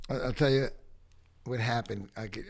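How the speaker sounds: ordinary talk, nothing marked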